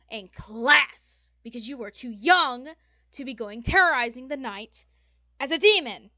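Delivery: building to a loud, furious telling-off; demon lady scolding. Acted. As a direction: chew out a wayward youngster, hammering key words.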